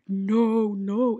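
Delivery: mock alarmed refusal. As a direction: act out her alarmed protest. Roommate's alarmed refusal, retold.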